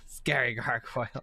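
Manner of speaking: ordinary talk, nothing marked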